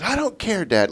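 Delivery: high sassy I don't care; mock defiant child. Father imitating daughter's defiance about the cold, high childish retort. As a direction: throw it back like a stubborn little girl.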